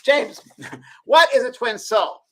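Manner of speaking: high, loud, slow mock question; mock Oprah. Imitating Oprah asking about twin souls, high slow mock host. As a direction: ask it grandly. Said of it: "she goes".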